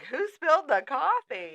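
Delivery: high, loud shocked outcry; shocked coworkers. Retold or imitated. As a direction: gasp it out in disbelief. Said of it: "people are, like, coming in like".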